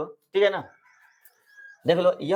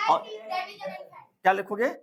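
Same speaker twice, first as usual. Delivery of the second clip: coaxing prompt; 12 semitones higher, 4 dB quieter, much slower than usual. Acted. Teacher prompts child in high voice.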